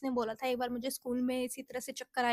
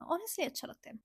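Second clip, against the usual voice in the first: laughing softly; 3 semitones higher, 9 dB quieter than usual. Real, not acted. Laughs, admits patting self feels good.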